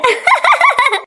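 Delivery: high, rapid peals of laughter. Acted. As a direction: cackle high at the lisping bride. Mocking laughter at lisping bride.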